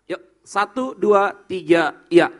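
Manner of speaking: loud, drawn-out count to start the reading; slow count-in. Real, not acted. Pastor counts the congregation in to read aloud, slow and loud. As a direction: lead the congregation in together. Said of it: "kita baca ini sekali lagi".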